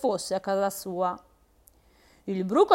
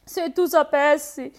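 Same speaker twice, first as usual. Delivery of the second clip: ordinary delivery, nothing marked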